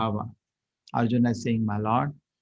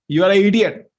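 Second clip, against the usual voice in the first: loud, higher, contemptuous put-down; insulting rebuke; 7 semitones higher, 10 dB louder than usual. Shown as a demonstration. Teacher insulting a student the wrong way, loud harsh rebuke. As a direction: throw the insult at him harshly. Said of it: "If you speak like that".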